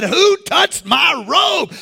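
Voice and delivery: slow, high shouted question; calling out to crowd. Acted. Jesus turning to the crowd, slow high shout. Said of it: "turned around to the crowd and said".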